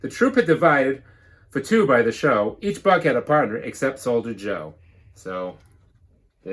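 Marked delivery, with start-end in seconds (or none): 0.0-4.7 lively storybook read-aloud; bouncy rhyming storybook narration; reading to children. Acted. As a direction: read it like a picture book to the class. Teacher reads picture book.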